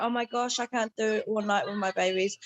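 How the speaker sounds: usual speaking voice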